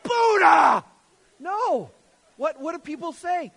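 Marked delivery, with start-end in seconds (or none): 0.0-0.8 very loud, high drawn-out shout; mock yell. Hammering a nail, slipping, yelling the name in pain. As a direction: yell it like you just hit your thumb. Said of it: "Do you yell that?".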